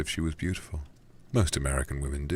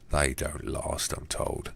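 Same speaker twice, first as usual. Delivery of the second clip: put-on Cockney accent; much slower than usual. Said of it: "a Cockney voice for this guy".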